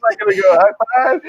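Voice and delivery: mock baffled church friend; incredulous question. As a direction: tease her for leaving you hanging. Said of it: "she goes, like".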